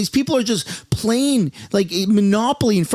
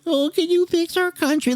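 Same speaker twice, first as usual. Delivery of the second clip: mock child voice; 9 semitones higher than usual. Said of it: "a four-year-old kid who said".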